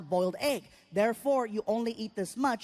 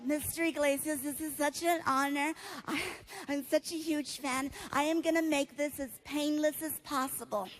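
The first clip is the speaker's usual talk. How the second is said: soft, slightly higher nurse voice; sweet, hushed fan voice; nice nurse; 3 semitones higher, 4 dB quieter than usual. Acted. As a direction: gently gush like an adoring nurse. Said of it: "The nurse is really nice".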